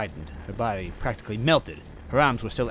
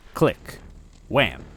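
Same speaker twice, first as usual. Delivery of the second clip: ordinary delivery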